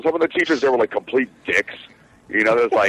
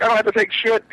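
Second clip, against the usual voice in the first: much higher, mock-defiant retort; 13 semitones higher than usual. Student defying the teacher, high mock defiance. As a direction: toss it back like a cocky student. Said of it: "I'm like".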